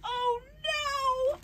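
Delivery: high mock sob; sobbed Oh no. A narrator's reading. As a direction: squeak a pretend sob. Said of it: "Ava sobbed".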